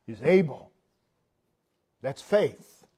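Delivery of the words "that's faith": ordinary talk, nothing marked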